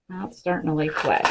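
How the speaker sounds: usual speaking voice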